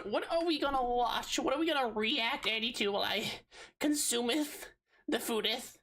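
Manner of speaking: high hammy olde-English voice; mock old English. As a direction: play it like a pompous herald.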